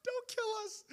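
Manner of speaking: high-pitched frightened squeal; mock terrified plea. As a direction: beg for your life in a hammed-up squeak. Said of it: "Probably still screaming".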